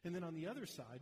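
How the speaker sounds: normal talking voice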